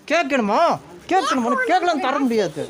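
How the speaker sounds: high, sing-out mock offer then refusal; teasing taunt. Real, not acted. Vlogger dangles cake before the little girl, teasing.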